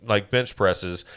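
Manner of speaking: ordinary talk, nothing marked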